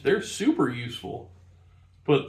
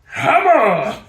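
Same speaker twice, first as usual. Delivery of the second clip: loud high burst; shouted hammer; 11 semitones higher, 12 dB louder than usual. Pretend. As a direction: bellow it out like a brute. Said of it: "your version of finesse is".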